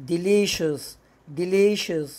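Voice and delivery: delicious with sh, de-li-shus; delicious with a clear sh in the middle; teacher demo of delicious with sh; sh sound in delicious. Shown as a demonstration. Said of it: "هتنطق شين".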